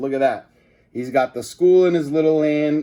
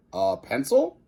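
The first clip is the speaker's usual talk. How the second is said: puzzled question. A narrator's reading. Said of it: "asked reporter Ashley".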